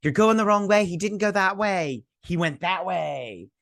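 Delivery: high, urgent put-on voice; imitating Nori. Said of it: "She's like".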